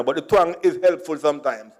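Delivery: ordinary talk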